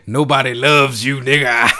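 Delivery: loud mocking jeer breaking into laughter; hammed-up cackling taunt; hearty laughing. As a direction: imitate a wife laughing from the soul. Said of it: "from the soul laugh".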